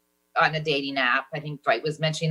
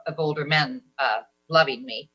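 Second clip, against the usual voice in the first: usual speaking voice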